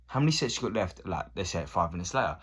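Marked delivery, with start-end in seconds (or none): 0.1-1.1 asked again, pitched up and pushier; impatient higher-pitched repeat question; pushy higher repeat ask. Retold or imitated. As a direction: nag for the gym machine a second time. Same asker asking again, pushier.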